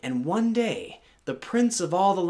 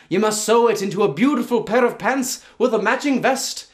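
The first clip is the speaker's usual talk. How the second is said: high, loud and quick, a gleeful trickster; leprechaun voice; 6 semitones higher, 6 dB louder than usual. Acted. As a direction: cackle through the rules like a meddling sprite. Leprechaun sets the task.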